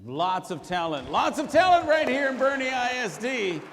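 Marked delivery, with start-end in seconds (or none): 1.1-3.6 high, loud crowd-pumping cheer; big proud showman's holler; rousing emcee shout. Acted. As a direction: belt it out to the whole hall. Host hypes the repeat to crowd.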